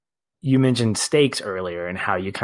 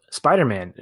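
ordinary talk, nothing marked

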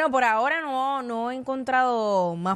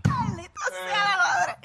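pained laugh, high and slow; laughing through pain; 7 semitones higher than usual. Real, not acted. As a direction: groan it while laughing. Reacting to another diss.

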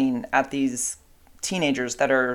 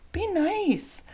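much higher "Be nice" with the weight on "Be", falling; firm warning to dog; 4 dB quieter than usual. Real, not acted. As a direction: insist the dog behave, high and firm. Telling dog be nice again.

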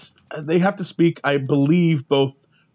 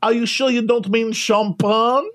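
pushy attendant; 6 semitones higher than usual. Retold or imitated. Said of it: "the woman looks at her and goes".

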